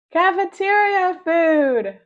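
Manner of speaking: loud, high, slow triumphant shout; eureka shout. A narrator's reading. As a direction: shout the brilliant idea. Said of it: "they shouted".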